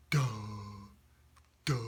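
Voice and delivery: dopey sound from the back of the throat; slow-witted character. Shown as a demonstration. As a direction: say it like a not very bright turtle. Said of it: "And his sound is".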